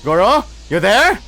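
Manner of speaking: loud, high worried calls; calling out with rising fear; anxious calling. Acted. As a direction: shout across the forest for a friend. Calling out for missing friend.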